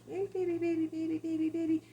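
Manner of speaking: imitating an owner cooing at a faraway dog; high sweet baby talk. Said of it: "they're like".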